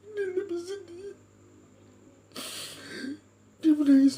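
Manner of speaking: high, tearful voice swelling loud then thinning; real crying. Real, not acted. Preacher telling congregation how God wept, crying. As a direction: break into sobs while testifying. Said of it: "saya tidak mau menangis".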